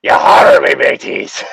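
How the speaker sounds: loud growled pirate shout. Pretend. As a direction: play a rowdy pirate, yelling. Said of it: "You sound like a fucking pirate".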